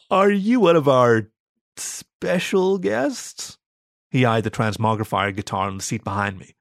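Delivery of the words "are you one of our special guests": high, slow, hesitant question; tentative wonder, stretching the words; awestruck guard. A narrator's reading. Stunned guard asks talking dog.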